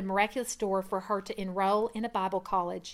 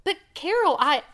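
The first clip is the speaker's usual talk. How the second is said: high, louder startled objection; flustered protest; 8 semitones higher, 7 dB louder than usual. Acted. Husband protesting to his wife, flustered and startled. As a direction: start to object and get cut off.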